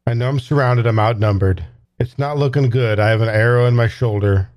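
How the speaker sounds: normal talking voice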